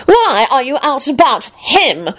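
high, sniffy disapproval; officious and affronted; disapproving. A narrator's reading. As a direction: question her like a pompous prig. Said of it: "Percy peered at her disapprovingly and sniffed".

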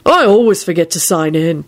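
a touch of gloom; understated glum admission; gloomy. A narrator's reading. Neville to Elizabeth about the trolls, mildly gloomy. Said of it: "he said gloomily".

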